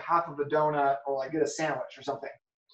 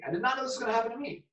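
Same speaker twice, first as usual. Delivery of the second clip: fast, higher, rattled repeat; fast, higher stunned repeat; rushed disbelief; 6 semitones higher, much faster than usual. Retold or imitated. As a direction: rush it out again in shock.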